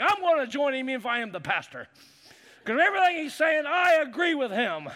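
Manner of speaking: rising into a laughing shout; fired-up agreement. Retold or imitated. Pastor retelling how he wanted to join the angry passenger, laughing shout. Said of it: "I was like".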